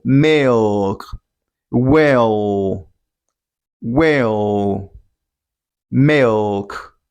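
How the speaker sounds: 'milk' and 'Well' drawn out with oh-like L; dark L. Shown as a demonstration. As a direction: let each L sink into a long 'oh'. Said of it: "It's oh".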